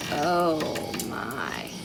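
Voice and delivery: ordinary delivery, nothing marked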